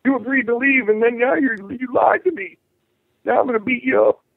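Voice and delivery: accusing cop. Acted. Voicing an angry cop confronting you.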